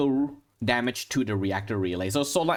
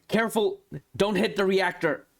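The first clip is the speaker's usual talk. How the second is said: higher-pitched mock shout of alarm; mild acted warning shout; 7 semitones higher than usual. Game master voices Reed warning the shooter, a mild high shout. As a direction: call out a warning without full volume. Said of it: "Reed is gonna shout from a corner".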